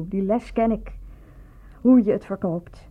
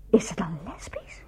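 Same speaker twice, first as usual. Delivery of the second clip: shocked whisper; 3 semitones lower, 7 dB quieter than usual. Acted.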